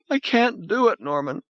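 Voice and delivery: high, breaking woman's voice; tearful refusal. Acted. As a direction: refuse through sobs. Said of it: "Crying like a child".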